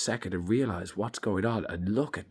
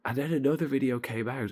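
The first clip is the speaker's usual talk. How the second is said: usual speaking voice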